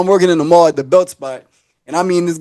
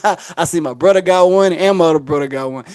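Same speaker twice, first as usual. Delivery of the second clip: ordinary talk, nothing marked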